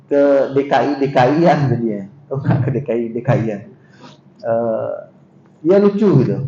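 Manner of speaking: usual speaking voice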